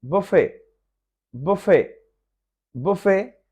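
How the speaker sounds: बुफे as "boo-fay", no t; buffet, t silent. Shown as a demonstration. Teacher drilling buffet with the silent t. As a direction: end बुफे on "fay" and drop the t. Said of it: "टी silent है".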